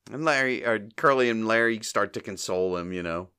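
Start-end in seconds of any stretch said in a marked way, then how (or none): none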